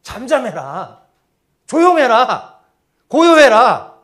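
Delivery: building to a loud, high shout; scolding. Acted. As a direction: scold noisy followers with three sharp orders. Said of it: "꾸짖는 것처럼 들렸을 겁니다". (제자들을 꾸짖듯 점점 크게 호통)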